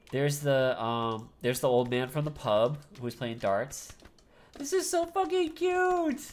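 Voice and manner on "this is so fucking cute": squealing. Real, not acted. Squealing over cute village.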